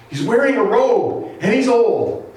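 high-pitched acted woman's report; alarmed medium. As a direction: describe the apparition in a startled high voice. Frightened medium describes Samuel.

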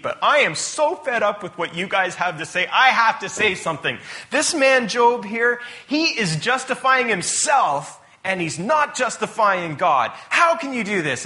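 high, forceful indignant outburst; fed-up rant. Retold or imitated. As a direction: play a young man who can't hold back. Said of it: "goes on a big rant".

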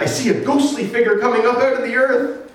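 high-pitched, startled acted report; alarmed medium. Frightened medium describes the ghost.

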